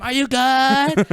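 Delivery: high, loud, drawn-out mimicry; friend having fun. Friend enjoying the ride checks on rider, high gleeful call. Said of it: "She's having a great time".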